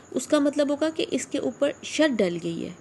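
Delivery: usual speaking voice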